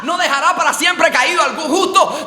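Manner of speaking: high, strong preaching voice; fervent preaching. Acted. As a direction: proclaim it over the church. Preaching at full force.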